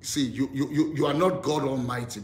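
ordinary talk

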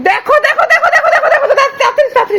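high urgent calls to come look; excited calling. Real, not acted. Mother calls family to watch the baby, excited. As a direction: call out fast and eager to come see.

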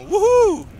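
high, loud woohoo; excited whoop. Real, not acted. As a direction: cheer at the top of your voice. Learned dragon shout.

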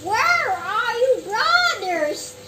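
loud calls for the brothers; calling brothers loudly across the yard. Acted. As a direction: shout for them to come help.

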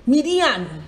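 "Midian" with a long, falling final AHN; shown pronunciation. Shown as a demonstration. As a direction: repeat "Midian" deliberately as mee-dee-AHN. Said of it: "it's pronounced".

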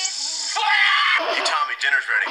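shouting across the house; calling kids to dinner. Acted. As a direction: call the kid down to eat.

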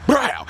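sudden high burst of noise; imitated door-kick noise. He kicks the fucking door, imitated crash. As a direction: mimic a door smashing in.

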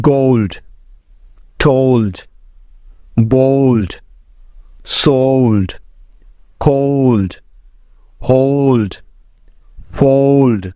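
each word said slowly and clearly, ending on a falling tune; word list demo. Shown as a demonstration. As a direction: model every o sound clearly, word by word. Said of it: "যেমন দেখো". (ধীরে স্পষ্ট উচ্চারণে শব্দ তালিকা)

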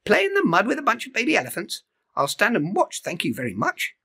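high, fast haughty bird voice; prissy Iris. Acted. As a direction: rattle off a prim refusal. Iris fussy refusal.

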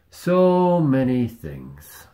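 slow and loud, stretching it out; loud drawn-out repeat. Shown as a demonstration. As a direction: belt it out slowly, drawn out.

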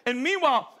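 ordinary talk

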